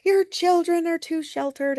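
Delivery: high, mocking sneer at the parent; sneering teen impression. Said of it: "they're like".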